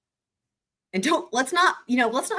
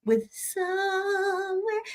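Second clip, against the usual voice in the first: slow, stretched mouse-voiced singing; a hint of a singing mouse; mouse mode; 11 semitones higher, 4 dB quieter, much slower than usual. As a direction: slip into a little cartoon mouse voice. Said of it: "I switched over to mouse mode".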